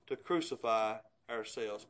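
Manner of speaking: usual speaking voice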